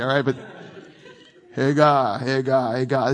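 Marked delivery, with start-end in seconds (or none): 1.5-3.1 low, repeated rumbling lion word; lion talk. Shown as a demonstration. Preacher showing how a lion talks, light lion voice. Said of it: "the way you would talk as a lion".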